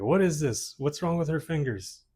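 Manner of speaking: high-pitched, half-hearted Brazilian accent imitation; attempted Brazilian accent. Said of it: "I can't really do a Brazilian accent".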